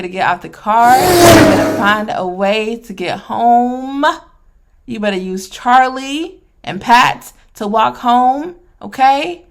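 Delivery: hammed-up order for the father to walk home; pretend yell.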